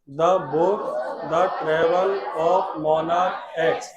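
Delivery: very slow, calm, word by word; slow dictation. Shown as a demonstration. Teacher leading students in slow calm recitation. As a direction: lead the class through it slowly. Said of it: "साथ में बोलना सभी लोग बेटा".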